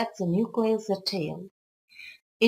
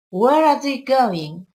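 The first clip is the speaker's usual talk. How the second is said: slow, louder, higher-pitched question on "Where are they going"; question intonation; 4 semitones higher, 7 dB louder, slower than usual. Shown as a demonstration. Teacher showing learners an example question, questioning tune. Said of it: "It's a question".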